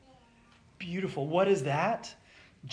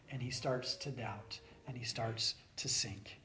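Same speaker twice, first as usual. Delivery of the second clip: drops to a soft near-whisper; hushed storytelling; 3 semitones lower, 9 dB quieter than usual. A narrator's reading. Preacher telling how Peter sank, in a hush. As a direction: let the words sink with him.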